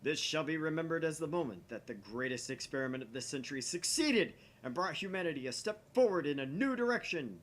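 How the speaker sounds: loud, swelling mad scientist speech; grandiose proclamation. Acted. Okabe proclaims his great experiment to the lab, grandly. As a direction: declaim it like history is watching.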